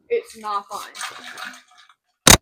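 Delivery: drawn-out insistence. Real, not acted. Friend insists he isn't fine.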